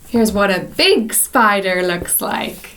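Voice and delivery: usual speaking voice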